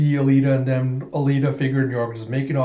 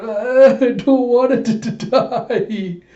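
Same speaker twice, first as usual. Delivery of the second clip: high, loud mock-terrified wail; wailing cry; 10 semitones higher, 6 dB louder, slower than usual. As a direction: wail in hammed-up fear of dying. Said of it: "York calls out".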